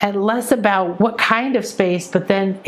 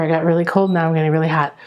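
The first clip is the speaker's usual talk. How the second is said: ordinary talk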